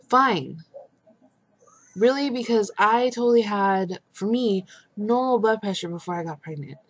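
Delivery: loud, high indignant rant; fed-up mother-to-be venting loudly; exasperated outburst. Real, not acted. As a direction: let the frustration burst out. Venting about doctors.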